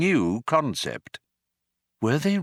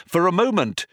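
ordinary delivery, nothing marked

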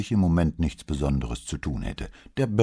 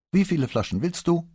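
higher, defiant question; 13 semitones higher, 4 dB louder than usual. A narrator's reading. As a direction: give in, but make him hear the sulk. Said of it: "fragte sie trotzig".

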